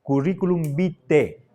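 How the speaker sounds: "vitae" ending in plain E, as BI-te; "Curriculum vitae", AE read as one E; Castilian pronunciation. Shown as a demonstration. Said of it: "en castellano se pronuncia vitae".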